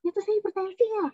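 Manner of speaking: quick, high-pitched mock surprise; imitated realisation. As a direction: voice a relative's sudden discovery. Voices relative learning diagnosis.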